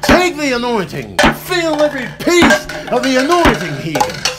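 loud, high hammed-up preacher proclaiming; over-the-top sermon voice; mock preacher. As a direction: holler it like a tent-revival preacher. Preacher-style chant over absurd item.

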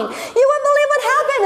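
fast, high, breathless news-telling; excited villager. Retold or imitated. Villager running to tell town, excited. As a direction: blurt it out like a villager who just saw a miracle.

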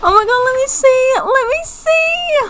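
gasp, then high eager squeals; excited squealing. Real, not acted. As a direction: beg excitedly, stretching out the last plea. Eager to see alien baby.

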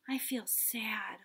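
soft, slow, sad telling; sad little voice. Shown as a demonstration. Child telling Mom about a feeling, softly sad. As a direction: sound sad as you tell Mom. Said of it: "We might tell Mom".

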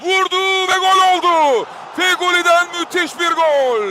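rising excited goal shout; goal call. Real, not acted. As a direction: call the goal with full force.

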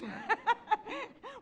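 high burst of laughter; spontaneous high chuckle. Real, not acted. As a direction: laugh while recalling it. Laughs recalling the concert.

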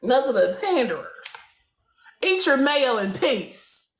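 ordinary delivery

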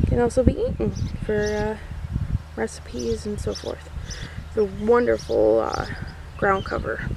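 ordinary talk

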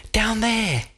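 sharp high cry of sighting; derby cries out. A narrator's reading. The derby spots the barge and cries out. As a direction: call it out, pointing. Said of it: "the derby cried".

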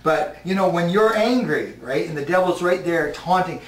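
ordinary delivery

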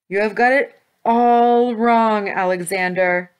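loud, slow, emphatic protest; crying out. A narrator's reading. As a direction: cry it out in dismay, drawing out the words. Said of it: "he cried".

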